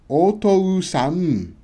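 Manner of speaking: "O to u sa n," chopped into beats, slow; choppy wrong reading. Shown as a demonstration. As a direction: read "O to u sa n," one piece at a time. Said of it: "that doesn't sound good".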